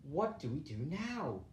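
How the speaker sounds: a hint of tears, voice drops; sobbing. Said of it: "sobbed Pipkin".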